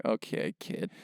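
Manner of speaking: quiet, dismissive mock reply. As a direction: toss it off under your breath, unimpressed. Mocking imagined podcast listener.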